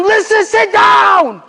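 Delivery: high, slow, booming order to sit down; commanding shout. Retold or imitated. Imam halting the angry uncles, slow commanding shout. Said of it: "he tells them".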